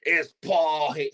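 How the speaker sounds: slow, shouted wrestler impression that breaks off; a failed try at a wrestler's shout; botched loud wrestler voice, cut off; Paul Hayes impression. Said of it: "I can't even get the voice right".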